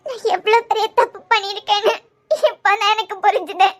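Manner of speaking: high tearful apology; weeping, pleading voice; crying. Acted. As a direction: beg forgiveness through sobs. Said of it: "பின்ன அழுதுகிட்டே இரு".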